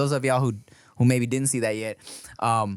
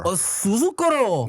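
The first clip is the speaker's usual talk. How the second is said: mock mispronunciation; 8 semitones higher, 3 dB louder, slower than usual. Mangling the car name.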